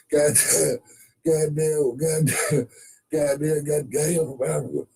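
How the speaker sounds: high-pitched comic character voice; cartoonish high rancher voice; high put-on voice. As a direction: squeeze the voice up high. Playing a character in a sketch.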